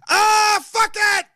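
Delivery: sudden high-pitched yell; scream. Acted. Yelling his plan to go out on his own terms. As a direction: let it rip, loud and high. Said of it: "I shouldn't scream like that".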